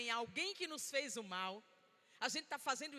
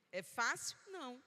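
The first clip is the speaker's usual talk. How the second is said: ordinary talk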